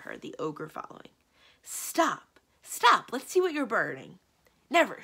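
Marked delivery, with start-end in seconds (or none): 1.6-4.2 loud, high cries to halt; urgent shout. A narrator's reading. Chasing her to stop the burning. 4.7-5.0 loud, high, single-burst refusal; defiant shout. A narrator's reading. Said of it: "shouted the enchantress".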